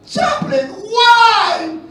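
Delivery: high, loud pleading cries of why; grieving father's desperate shout; anguished cry. Retold or imitated. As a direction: cry out to the chaplain like a broken father. Grieving father crying out to chaplain.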